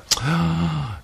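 a sharp, soft mock gasp of shock. Host mock-gasping at Vicky Fowler's name. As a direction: gasp as if scandalised.

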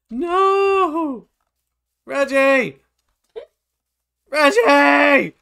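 long, high yell for someone; loud, high, drawn-out cry; desperate shouting. Real, not acted. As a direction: bellow the name in desperation. Calling out to the fox in game.

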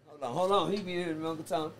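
ordinary talk

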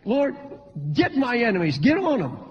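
high-pitched, acted urging; demanding plea. Believer praying for God to get enemies, mock demanding.

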